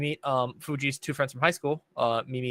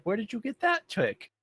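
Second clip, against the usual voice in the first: mock astonished ask, pitched higher; amazed question; 10 semitones higher than usual. Viewer reacting to the tanuki's umbrella trick, high mock amazement. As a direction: act stunned by the dog's trick. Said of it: "And you're like".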